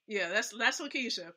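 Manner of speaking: usual speaking voice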